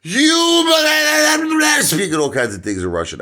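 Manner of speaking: shrieked high and loud outrage; furious shout. Retold or imitated. Insulted waiter screaming at the comic, shrieked high. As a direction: explode at him in a rage.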